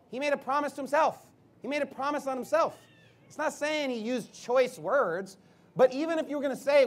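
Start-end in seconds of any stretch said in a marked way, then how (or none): none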